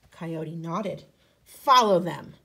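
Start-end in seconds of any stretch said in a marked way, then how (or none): 1.4-2.3 commanding. Acted. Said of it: "said the Great Spirit".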